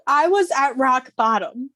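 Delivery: real emotion, loud and emphatic; getting emotional. Real, not acted. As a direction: declare it through welling emotion. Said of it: "So sorry, I'm getting emotional".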